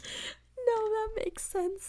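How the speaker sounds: high, slow fake sob; mock crying. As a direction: whimper it like mock despair.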